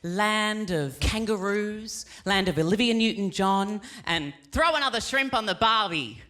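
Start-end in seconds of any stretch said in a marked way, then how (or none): none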